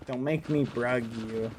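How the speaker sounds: ordinary delivery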